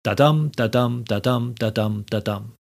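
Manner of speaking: DA-DUM beats, weak da then stressed dum; iambic rhythm. Shown as a demonstration. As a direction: chant each da-dum as unstressed then stressed, letting the last ones fall away. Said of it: "a line of poetry has the rhythm".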